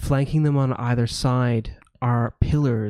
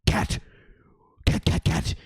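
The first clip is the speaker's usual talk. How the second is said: mild, hushed barking hound. Acted. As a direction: bark the word like a startled dog. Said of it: "The hound wakes up and goes".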